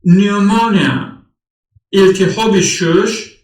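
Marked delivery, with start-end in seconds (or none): 0.0-1.2 نیومونیا said as nyoo-mo-nia, P silent; careful repeat of نیومونیا. Shown as a demonstration. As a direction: model نیومونیا clearly for the class.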